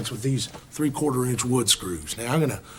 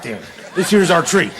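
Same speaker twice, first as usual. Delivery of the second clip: ordinary delivery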